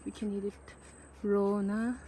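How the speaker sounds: usual speaking voice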